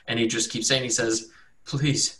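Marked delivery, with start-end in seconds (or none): 1.6-2.2 pleading captive. Acted. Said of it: "looking up at you guys with faintness".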